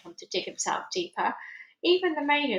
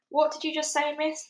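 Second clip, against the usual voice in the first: demanding major; 7 semitones higher than usual. Acted. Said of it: "demanded Major".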